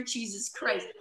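ordinary talk

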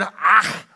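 "ah" with a throaty ch ending; Hebrew pronunciation. Shown as a demonstration. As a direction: scrape the end of "ah" in the throat. Repeats the demonstrated Hebrew word.

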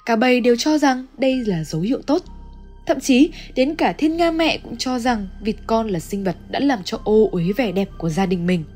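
ordinary delivery, nothing marked

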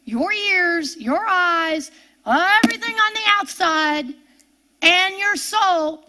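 ordinary talk

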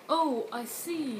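acknowledging news. Shown as a demonstration. Listener confirming new information, slow dawning acknowledgement. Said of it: "if you want to say".

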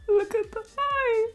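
laughing so hard it turns to crying; crying with laughter. Real, not acted. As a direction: squeal it through tears of laughter. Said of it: "I'm crying because I'm laughing".

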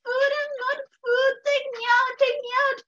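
high, crying yelps of a burned coyote; screaming in pain. Acted. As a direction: wail like you're in a hot pot. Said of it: "And the coyote started screaming".

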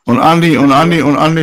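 calling Andi loudly over the others; loud, insistent repeated call. Real, not acted. Cutting into the fight to get Andi's attention, loud calling. Trying to be heard in argument.

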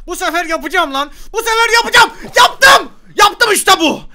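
determination climbing to a high scream; triumphant shouting. Real, not acted. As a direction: build to a loud cry of victory. Atlayışı başarıp seviniyor. (giderek tizleşen zafer çığlığı)

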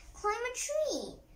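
high-pitched, restrained yell. A narrator's reading. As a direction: call it out high without full volume. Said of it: "yelled, yelled Biff".

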